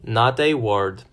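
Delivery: plain reading voice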